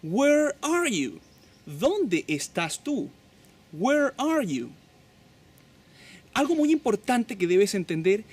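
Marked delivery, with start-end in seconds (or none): 0.0-4.7 higher, slow teaching voice; high model question. Shown as a demonstration. Teacher modeling the to be question to learners. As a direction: lift the voice and drill it slowly. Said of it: "tú puedes decirle".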